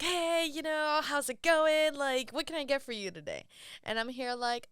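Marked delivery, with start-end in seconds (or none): 0.0-3.5 higher-pitched, eager greeting; peppy customer-service voice, acted out; enthusiastic server. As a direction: imitate an overly keen counter kid. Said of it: "like, super enthusiastic".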